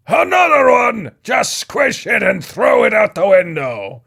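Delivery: high, loud call through the walls; shouting through walls. Acted. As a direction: bellow from downstairs, amused. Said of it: "shouted through the thin walls".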